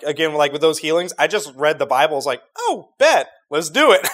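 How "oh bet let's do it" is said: high, eager snap; excited. Retold or imitated. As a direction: jump at it eagerly. Retold reaction to reading.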